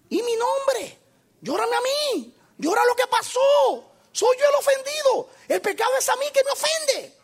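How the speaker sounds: high, loud ringing divine voice; God crying out that he is the offended one; preacher voicing God, pitched high and loud. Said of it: "Me dice que".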